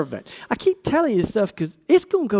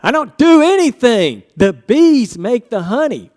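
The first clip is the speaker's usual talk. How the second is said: emphatic; 5 semitones higher, 8 dB louder than usual. Real, not acted. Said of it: "emphatic answer".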